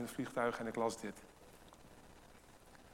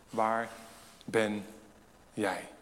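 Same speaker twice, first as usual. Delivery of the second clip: slow, word by word; 4 semitones lower, much slower than usual. Real, not acted. Slow pointed question to listeners.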